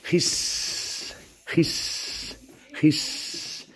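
whispered, breathy snake hissing. Shown as a demonstration. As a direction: hiss it out like a snake, no voice. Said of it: "What's the sound of the snake?".

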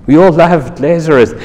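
ordinary talk

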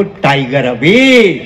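drawn-out slogan, pitched low; low, slow rallying call. Real, not acted. Slogan called out, first take.

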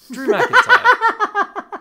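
high, loud, drawn-out announcer call; slow hammed-up announcer introduction; 1 ring announcer. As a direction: boom the name like a ring announcer. Hyping the wrestler name.

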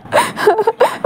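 a high, wailing sob. Acted. Wing breaking down on the phone, high wailing sob. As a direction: let out a high crying wail. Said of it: "और wing just रोने लगता है".

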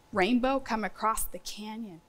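ordinary talk

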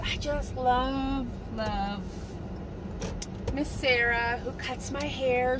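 ordinary delivery

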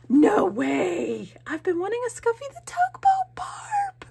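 very high squeal, held back from yelling; stifled excited yell. Real, not acted. Vlogger finds long-wanted book from friend, stifled squeal. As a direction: squeal with joy, trying not to yell. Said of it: "I'm trying not to yell".

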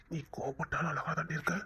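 soft unvoiced whisper of praise; hushed loving whisper; tender whisper. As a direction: whisper it lovingly into her ear. Tender whisper to sulking wife.

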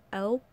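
elp said with no h sound; dropped h. Shown as a demonstration. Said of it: "she can't really say help".

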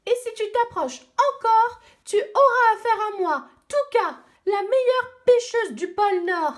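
put-on high, loud furious cries of a little girl; angry shouting girl. Little Eskimo girl screams at the penguin thief, furious. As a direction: yell it out like a robbed child. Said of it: "l'esquimaude continuait à crier".

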